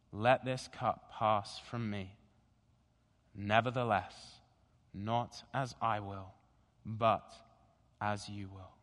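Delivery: slow, low, measured scripture reading; slow deliberate reading. Shown as a demonstration. Pastor reading Gethsemane to the congregation, slow and deliberate. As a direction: read each phrase slowly, letting it settle. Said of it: "I'm gonna read slowly and deliberately".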